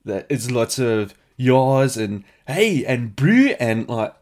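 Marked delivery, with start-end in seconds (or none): 1.4-2.0 loud mock South African accent. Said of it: "he's doing a South African accent". 2.5-2.8 put-on high, loud South African accent; exaggerated South African slang tag. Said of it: "he's doing a South African accent". 3.2-3.5 put-on high, rising South African accent, loud; exaggerated South African slang, pitched up. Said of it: "he's doing a South African accent".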